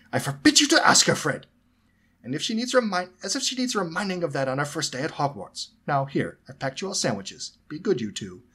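sharp high outraged mother. A narrator's reading. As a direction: snap it in waspish outrage. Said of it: "voice changed to waspus outrage".

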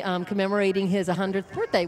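ordinary talk, nothing marked

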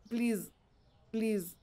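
soft, hushed begging under the breath; quiet pleading. Real, not acted. Gamer quietly begging the shop game to cooperate, hushed plea. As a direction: murmur it like a small prayer.

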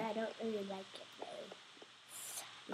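put-on slow, hushed British accent. British accent while complaining about the archery tower. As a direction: slip into a soft British accent. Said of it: "I'm talking in a British accent".

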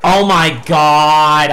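normal talking voice

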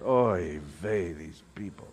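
low, very soft weary groan of words; exasperated sigh, sinking almost to nothing; deep sigh. Acted. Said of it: "he sighed deeply in his spirit".

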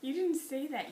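ordinary delivery

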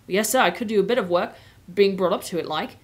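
put-on Cockney accent. Cabbie telling Aslan he can work, Cockney accent. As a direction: answer respectfully in a working Cockney accent. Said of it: "the Cockney accent is what I've been doing".

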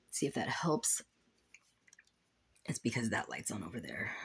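whispered aside. Real, not acted. Vlogger adjusting her light, whispering to herself. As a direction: breathe it to yourself while fixing the light.

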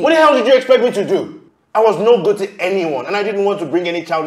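loud, high-pitched angry shout; angry outburst. Acted. Man defending himself to his accusing lover, angry outburst. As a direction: explode in self-defence. Quarrel at its height.

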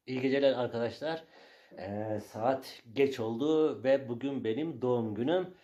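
slow, low, hushed mock address; mock solemn speech. To his followers, a slow hushed mock-solemn thanks. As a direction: deliver a fake heartfelt birthday thank-you.

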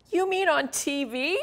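high, trembling little frog voice; shaky voice. Acted. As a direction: ask it nervously, voice wobbling. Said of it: "Freddie asked in a shaky voice".

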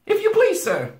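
high, loud frightened squeak; trembling. A narrator's reading. Frightened boy answering the wolf, trembling. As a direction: tremble as if barely able to speak. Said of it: "trembling so that he could hardly speak".

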